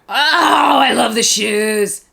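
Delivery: loud, high "oh" easing into slow gushing; delighted gushing. Real, not acted. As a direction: squeal with delight, drawing it out. Delighted on seeing doll's shoes.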